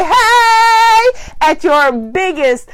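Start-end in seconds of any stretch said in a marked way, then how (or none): 0.0-1.1 loud, high, showy greeting; big bright hey. Retold or imitated. Walking into the room at full shine, loud high hey. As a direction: burst through the door with a loud hey. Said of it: "walk in the door like y'all did and say".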